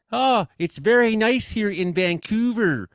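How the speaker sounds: a lightly teasing imitation of a Vancouver local's West Coast accent. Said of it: "I heard how people was talking here".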